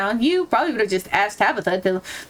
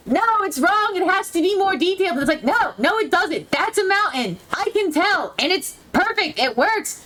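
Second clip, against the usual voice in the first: arguing with herself; 6 semitones higher than usual. Retold or imitated. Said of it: "I'm like".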